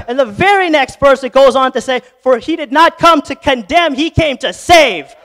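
high, loud preacher's cry; full-force preaching. Acted. Pastor preaching God's love to Peter, loud and soaring. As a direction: shout the promises over the congregation.

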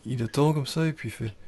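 ordinary delivery

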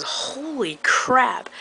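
high, slow, drawn-out awe; amazed exclamation. Retold or imitated. Recalling her reaction to the hack, high slow awe. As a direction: stretch it out in delighted shock. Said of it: "the first thing I thought was".

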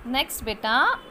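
usual speaking voice

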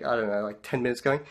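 normal talking voice

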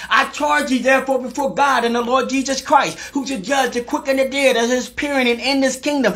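high, sustained scripture proclamation; full-force preaching. Real, not acted. Preacher giving church the charge before God, high full-force preaching.

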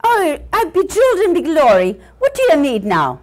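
loud, put-on Irish brogue; Irish setter. Irish setter responds to puppy's interruption, Irish accent. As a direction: answer as an impatient Irish dog.

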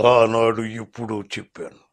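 low, slow baritone impression; weighty, drawn-out film-star delivery; NT Rama Rao. Shown as a demonstration. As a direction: draw it out gravely, deep in the voice. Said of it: "దాంట్లో బోల్డెన్ variations ఉంటాయి".

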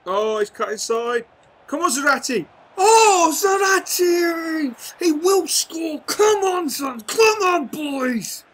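very high, very loud cries; excited yelling. Real, not acted. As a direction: scream him on toward goal. Striker breaks toward goal.

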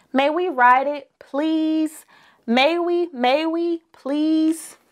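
high pleading little bears; begging cubs. A narrator's reading. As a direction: plead again and again for the ride.

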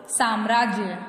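"साम्राज्य" opening on a long "saam"; correct pronunciation. Shown as a demonstration. Teacher answering what it is, correctly pronounced. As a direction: stretch the first a of "साम्राज्य". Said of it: "शुद्ध शब्द क्या हुआ बच्चों?".

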